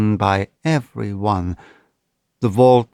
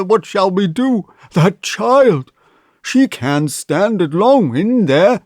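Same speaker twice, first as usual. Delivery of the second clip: put-on higher, loud, shaken old banker; shaky voice; 6 semitones higher, 3 dB louder than usual. A narrator's reading. Banker telling the mother nobody can open the door. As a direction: speak like a frightened old man. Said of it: "in a shaky voice".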